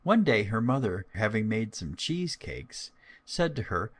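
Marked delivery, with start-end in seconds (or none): none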